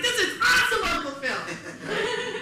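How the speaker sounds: put-on high, loud kid-like thrill; excited nephew. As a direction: cry it out, overjoyed, stretching the end. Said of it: "my nephew's like".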